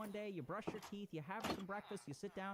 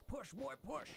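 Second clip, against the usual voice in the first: much higher, barked coach urging; drill coach; 7 semitones higher than usual. Retold or imitated. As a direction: push him like a drill sergeant. Willpower as inner coach.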